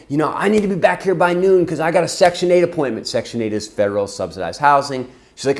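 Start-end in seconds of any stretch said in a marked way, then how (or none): 0.1-3.1 imitated angry mom, loud and high; furious yelling. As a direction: yell it like a fuming mother. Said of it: "she's cursing and yelling at us".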